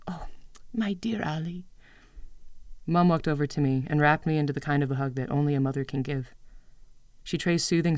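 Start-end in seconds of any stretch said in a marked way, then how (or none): 0.1-1.7 whispered motherly comfort; tender whisper. Acted. Mother whispering comfort to her daughter. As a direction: hush it like soothing a child.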